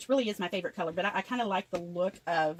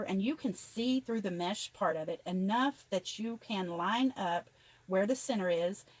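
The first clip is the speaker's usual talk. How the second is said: ordinary delivery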